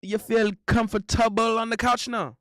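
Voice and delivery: put-on playful Caribbean accent teasing a friend; mock Caribbean accent. Streamer teasing Quick Slap in a Caribbean accent. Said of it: "That's how we say it".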